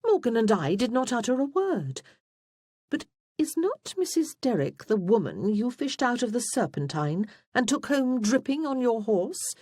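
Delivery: high-pitched, lofty protest; haughty. A narrator's reading. Freya challenges Wulfric about his rescued guest, haughty. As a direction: lift the voice well up and challenge him. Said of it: "Freya said haughtily".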